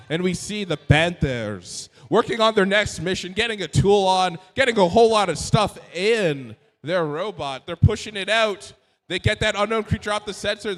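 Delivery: excited live commentary; play-by-play commentary. Acted. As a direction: call the match as it happens.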